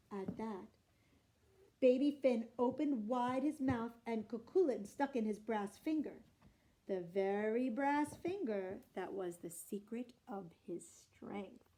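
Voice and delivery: usual speaking voice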